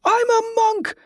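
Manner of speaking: high-pitched scream to a crowd. A narrator's reading. Jordan drawing the mob's attention, high screaming. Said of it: "Jordan screamed".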